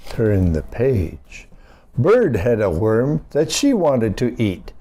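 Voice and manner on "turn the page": usual speaking voice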